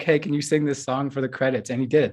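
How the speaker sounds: normal talking voice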